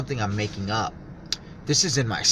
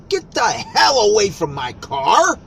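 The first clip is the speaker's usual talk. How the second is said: much higher, louder, slow retold yell; angry shout at a stranger; 8 semitones higher, 6 dB louder than usual. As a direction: bark it like chasing someone off. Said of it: "I go".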